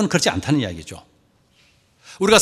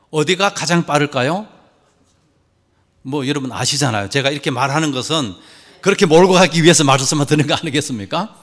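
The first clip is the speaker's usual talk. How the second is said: ordinary talk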